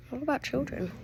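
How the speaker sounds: ordinary delivery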